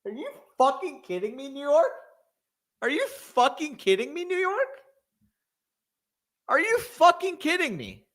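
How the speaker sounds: raging at New York's pick, voice up high; exasperated cussing. Real, not acted. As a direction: yell at the team in disbelief. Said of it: "I'm gonna cuss".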